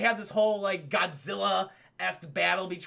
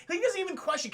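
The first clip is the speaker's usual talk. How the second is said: usual speaking voice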